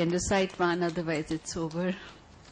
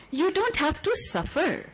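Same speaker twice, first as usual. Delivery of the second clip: higher opening take; 10 semitones higher than usual. Acted. Repeated poem line, first take.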